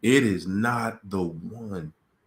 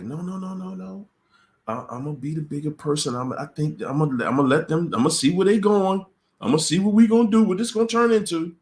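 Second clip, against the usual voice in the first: dismissive to eager; 7 semitones higher, 4 dB louder, faster than usual. Retold or imitated. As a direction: wave it off, then rush higher. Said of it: "And you like".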